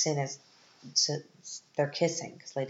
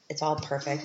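normal talking voice

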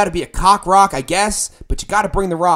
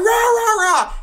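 wordless war cry, pitched way up; raging roar; 3 dB louder than usual. Retold or imitated. Squaring up to the other band, roaring wordless rage. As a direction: bark out rage sounds at them. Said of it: "And I'm like".

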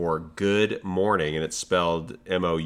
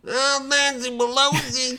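high, drawn-out wailing rioter voice; Long Island January sixth guy; 4 dB louder than usual. Acted. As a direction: attempt a high, slow Long Island rant. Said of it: "the Long Island January sixth guy".

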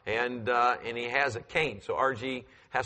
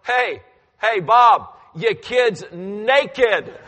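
loud, high shouted call across the yard; mock shout from neighbors, fast then dragged out; 8 semitones higher, 10 dB louder, much slower than usual. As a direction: yell it from the neighbor's lawn.

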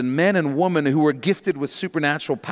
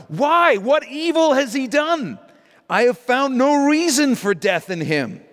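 high, louder pleading Pilate; Pilate's protest; 11 semitones higher, 4 dB louder than usual. Acted. Preacher voicing Pilate pleading with the crowd, high and raised.

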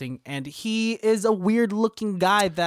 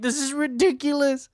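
mock crying; 8 semitones higher than usual. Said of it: "And she starts crying".

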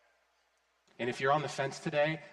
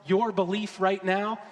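loud, high, driving exhortation; full-force preaching; 5 semitones higher, 5 dB louder than usual. Acted. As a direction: build the close and push hope at them.

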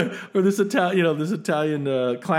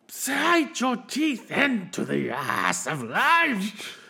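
big, slow, over-the-top line delivery, higher pitched; movie villain impression; 7 semitones higher, much slower than usual. Quoting the actor's famous movie line, hammy slow bellow. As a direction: stretch every word out, hugely hammed up. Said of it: "his famous line that always gets quoted".

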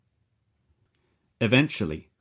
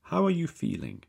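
higher, mock-concerned Slavic-accented question; 13 semitones higher, 5 dB louder than usual. Captor lifting the prisoner's chin, mock-caring Slavic accent. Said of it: "strong Slavic accent".

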